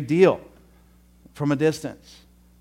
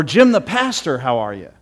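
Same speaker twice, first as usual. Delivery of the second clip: mock formal greeting; 8 dB louder than usual. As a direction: hail him by full name.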